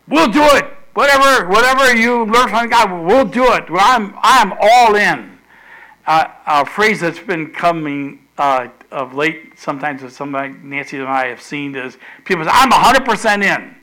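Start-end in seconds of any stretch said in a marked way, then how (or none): 0.1-5.4 loud, high burst of commitment; raised, over-eager declaration; eager pledge. Acted. Acting people's eager response to God. 12.5-13.7 emphatic pledge. Retold or imitated. Quoting people declaring total commitment.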